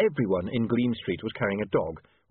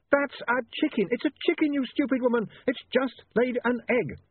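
very high, insistent scolding; exasperated shout. A narrator's reading. Harassed woman correcting someone with the wrong animal, exasperated. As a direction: lose all patience, pitched right up.

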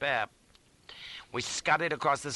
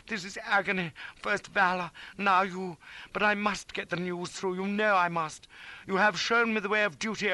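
high, earnest noble suffering; impassioned, pained vow; anguished farewell; 8 semitones higher than usual. A narrator's reading. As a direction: declare duty in an overwrought voice. Companion's emotional farewell.

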